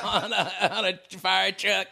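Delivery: ordinary talk, nothing marked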